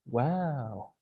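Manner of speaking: low, soft, drawn-out wow; awed wow. Acted. Crowd amazed at the miracle, awed wow. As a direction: breathe out a hushed wow. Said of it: "다시 한번 해볼까요".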